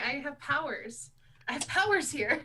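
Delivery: grandiose boasting. Acted. Grand boast about powers.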